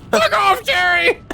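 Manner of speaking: mock angry shout. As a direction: snap it back in a high shout. Acted reply, high shout.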